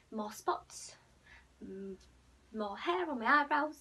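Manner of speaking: ordinary talk, nothing marked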